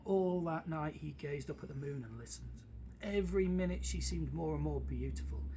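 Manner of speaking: lively storybook reading; story narration. A narrator's reading. Teacher reading a story to the class, animated narration. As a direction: read it like a bedtime tale, big and bright. Reads story aloud to listeners.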